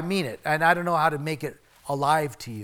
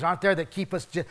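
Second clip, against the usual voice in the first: normal talking voice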